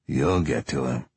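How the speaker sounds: low, even, neither insistent nor scolding; flat statement of fact. Acted. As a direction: state it coldly, no push. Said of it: "not insistent, not chastising, just a statement of fact".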